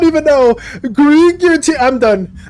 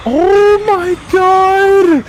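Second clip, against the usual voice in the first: high, very drawn-out disbelief; appalled exclamation; 5 semitones higher, much slower than usual. Real, not acted. Streamer seeing a nightmare monster, aghast and drawn out. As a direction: stretch each word out high, aghast.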